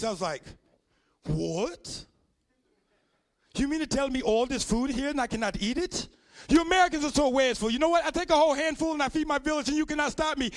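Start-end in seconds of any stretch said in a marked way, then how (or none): 1.3-10.5 faked foreign accent, getting louder on the wasteful part; mock African accent. Comic caught eating in store, mock African accent. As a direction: play the clueless foreigner, hammed up. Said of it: "straight ass face".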